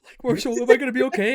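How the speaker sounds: high, loud mock-tearful plea; imitated crying. Injured friend asking if he'll be okay, imitated crying. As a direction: plead in a hammed-up crying voice. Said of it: "crying, like".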